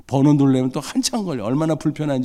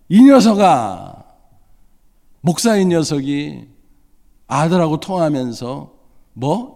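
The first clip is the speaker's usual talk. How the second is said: God scolding; 2 semitones higher, 4 dB louder than usual. Retold or imitated. God rebuking a pastor father for praying only as formality, stern scolding. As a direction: berate him like a stern God. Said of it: "막 책망을 하는 거예요".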